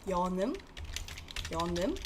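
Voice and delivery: yeonum said twice with a rising end; Korean term yeonum. Shown as a demonstration. Teacher drilling yeonum for students to copy. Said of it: "yeonum is a special rule".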